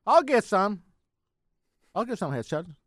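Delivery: ordinary talk, nothing marked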